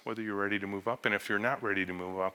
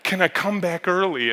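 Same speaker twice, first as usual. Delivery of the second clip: much higher and louder, slow, laughing in disbelief; begging to come back early, laughing at the madness; desperate half-laughing plea; 9 semitones higher, 6 dB louder than usual. Retold or imitated. Former employee phoning the boss, begging to return, laughing. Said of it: "She called me after four and said".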